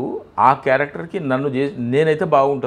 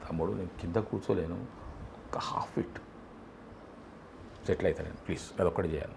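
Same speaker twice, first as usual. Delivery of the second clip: quiet pleading; 4 semitones lower, 13 dB quieter than usual. Retold or imitated. Pleading with assistant director for a seat.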